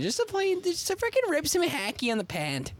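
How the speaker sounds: exaggerated Canadian hockey-player accent. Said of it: "Is that super Canadian?".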